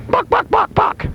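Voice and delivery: very high, squawky clucks; chicken clucking. Pretend. Clucks ending the demo impression.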